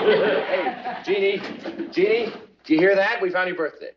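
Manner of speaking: high, eager calling; excited. Acted. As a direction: call her over with great news. Calls Jeannie with good news.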